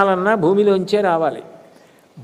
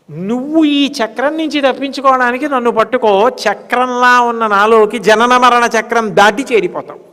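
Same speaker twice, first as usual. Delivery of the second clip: raised, ringing sermon voice; impassioned preaching; 5 semitones higher, 6 dB louder than usual. Acted. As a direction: urge the devotee from on high, pitched up. Preaches as God urging the devotee.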